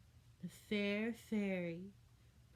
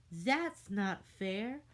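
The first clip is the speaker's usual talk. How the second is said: whiny complaint; 3 semitones higher, 6 dB louder, much slower than usual. A narrator's reading.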